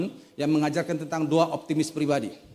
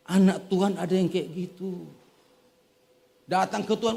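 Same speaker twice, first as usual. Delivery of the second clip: usual speaking voice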